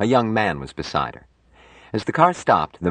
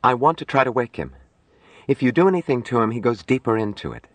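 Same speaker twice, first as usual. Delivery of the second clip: high, careful woman's voice; earnest young nurse; 7 semitones higher, faster than usual. Acted. Nurse asks doctor to let her wake patient, earnest. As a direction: make the case earnestly in a higher voice.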